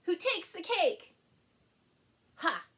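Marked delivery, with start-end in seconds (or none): none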